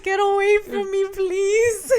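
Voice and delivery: high, loud mock-tearful begging; panicked plea. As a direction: beg as a hammed-up crying captive. Acting the captive pleading.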